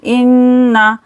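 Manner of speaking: इन्ना with a long nasal hum on the n; held, nasalised n in इन्ना; ghunna on nun. Shown as a demonstration. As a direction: hum through the doubled n of इन्ना. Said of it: "नून पर गुन्ना करेंगे".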